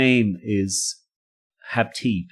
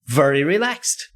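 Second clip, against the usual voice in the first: laughing; 13 semitones higher than usual. Real, not acted. Laughs at own calm.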